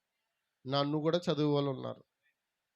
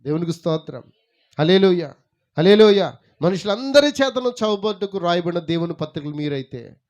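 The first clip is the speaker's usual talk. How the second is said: loud praise to the congregation; full-force preaching; 13 dB louder than usual. Acted. (బిగ్గరగా స్తుతిస్తూ ప్రసంగిస్తూ)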